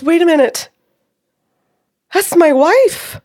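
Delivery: high, loud disbelief, drawing out WIFE; astonished realization. Retold or imitated. Client realizing his wife is present, loudly astonished. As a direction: let it dawn on you, loudly.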